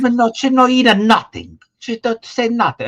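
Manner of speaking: put-on high, loud Italian-American accent, exclaiming; Italian-American relative. Host imitating her Italian-American relatives, loud high Italian accent. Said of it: "they all sound like that".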